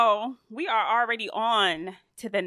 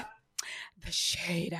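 whisper; 6 semitones lower, 12 dB quieter than usual. Acted. Whispered reaction to shade.

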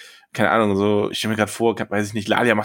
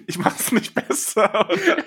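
laughing through the words; giggling while asking; slower than usual. Real, not acted. Laughing at own blunder.

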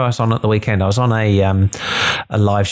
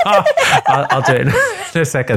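giggling through the words; can't stop laughing while talking. Real, not acted. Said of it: "you can't do it when you're smiling".

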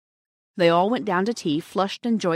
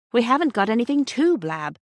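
higher, louder protest, mildly acted; indignant; 5 semitones higher, 5 dB louder than usual. A narrator's reading. Sister protesting to her brother, indignant. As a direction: protest as if wrongly accused. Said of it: "said Bobby indignantly".

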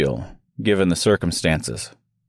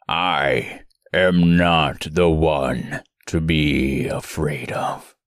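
very low, slow, fading threat; deep looming monster; 5 semitones lower, slower than usual. Acted. Monster taunting Dorothy.

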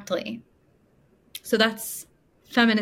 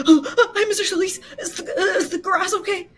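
higher, jittery, laughing fear; nervous squeak; 10 semitones higher, faster than usual. Retold or imitated. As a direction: squeak it like a terrified neighbour. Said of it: "John's like gasps".

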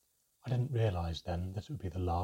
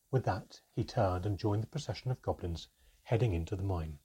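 ordinary delivery, nothing marked

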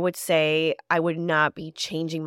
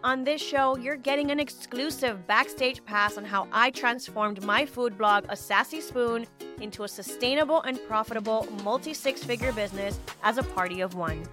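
upbeat, higher announcer read; bright intro like a radio host; podcast announcer; 5 semitones higher than usual. Acted. Recorded podcast intro over music.

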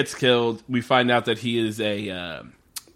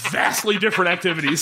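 ordinary talk, nothing marked

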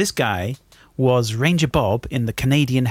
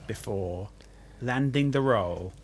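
softer, slow, with long pauses; quiet, drawn-out quiz clue; suspenseful build-up; 2 semitones lower, 8 dB quieter, slower than usual. Real, not acted. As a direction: hold back the reveal, hushed.

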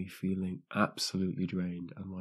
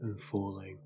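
soft meditation voice with long pauses; guided sleep meditation; 5 dB quieter than usual. Acted. Host guides listeners into sleep, guided meditation. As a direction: lull them gently towards sleep, phrase by phrase.

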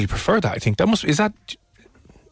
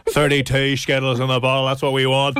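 hammed-up Antrim accent; old man's Antrim accent. Host mocking a caller's guess, bad Antrim old man. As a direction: put on an old Northern man's voice. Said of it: "put on an old man's voice".

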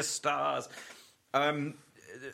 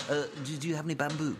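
panda voice; faster than usual. Pretend. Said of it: "panda ... going".

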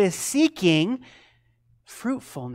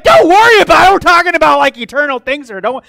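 loud, high-pitched disbelief; incredulous protest; 10 semitones higher, 17 dB louder, faster than usual. Retold or imitated. Tim answering back about eternal things, loudly incredulous. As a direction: throw the words back at full volume, aghast. Retold incredulous reply to mentor.

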